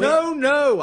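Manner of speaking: high, loud exasperated protest; exasperated correction. Acted. Caller correcting his colleague on the phone, exasperated. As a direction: cut in, insisting he has misunderstood.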